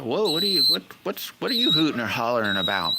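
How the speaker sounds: high, startled whoa, then fast; retold surprised call. Retelling asking his wife why she's hollering, high startled. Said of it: "I said".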